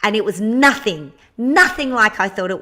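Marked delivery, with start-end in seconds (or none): none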